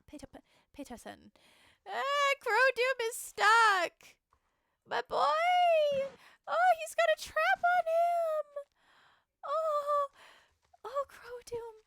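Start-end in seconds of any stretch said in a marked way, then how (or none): 1.9-11.8 panicked cry. Acted. Rider finds horse in trap.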